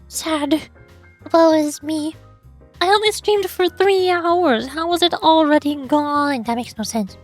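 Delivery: ordinary delivery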